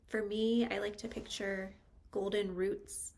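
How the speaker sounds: unhurried meditation guide, long pauses between phrases; calm, spacious meditation delivery with lingering pauses; guided meditation. Real, not acted. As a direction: guide them into stillness, phrase by phrase.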